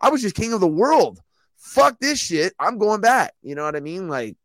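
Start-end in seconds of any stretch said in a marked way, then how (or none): 0.0-3.3 rising, higher, louder rant; imitating a fed-up fighter. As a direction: quit in disgust, mock outrage. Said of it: "he's gonna be like".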